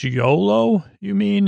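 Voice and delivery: a touch of a goofy Jon; mock quip. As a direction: toss it off in a lightly put-on voice. Jon quipping at Beric.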